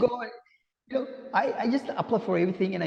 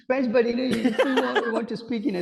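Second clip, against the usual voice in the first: laughing; 7 semitones higher, 3 dB louder than usual. Real, not acted. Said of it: "laugh heard".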